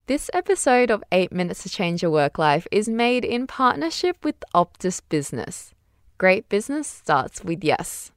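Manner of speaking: crisp sponsor announcement; ad read. Acted. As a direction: read it like a podcast ad.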